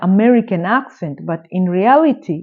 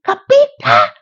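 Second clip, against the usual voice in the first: high, loud mock of a stern nun; imitating a strict nun demanding an answer; 11 semitones higher, 5 dB louder than usual. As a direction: bark it like the bossy nun.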